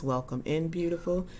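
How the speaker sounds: usual speaking voice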